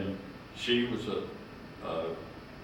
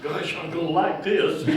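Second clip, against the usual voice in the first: higher, louder gleeful exclamation; delighted; 9 semitones higher, 7 dB louder than usual. Retold or imitated. Young man gleefully delighted by the campus girls. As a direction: relish it out loud.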